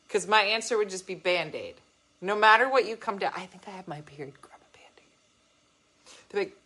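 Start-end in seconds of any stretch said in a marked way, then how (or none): 3.4-6.2 whispering. Pretend. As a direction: whisper it like a tired nurse. Whispered school nurse bit.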